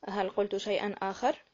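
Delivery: ordinary delivery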